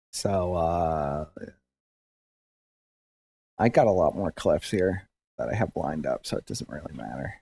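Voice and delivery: ordinary talk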